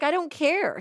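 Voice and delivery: high-pitched mock protest of an upset child; lightly acted angry kid; mad kid, retold. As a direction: imitate a kid insisting, pitched well up. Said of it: "he's, like, really, really mad".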